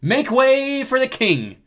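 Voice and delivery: high, loud, drawn-out herald's call; grand proclamation. A narrator's reading. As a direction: clear the crowd for royalty. Leo proclaiming himself to the crowd.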